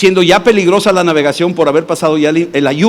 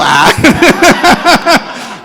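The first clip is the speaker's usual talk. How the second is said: high, loud bursts of laughter; hearty laugh; 10 semitones higher, 4 dB louder than usual. Real, not acted. After voicing his wife, hearty laughter. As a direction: laugh at your own story.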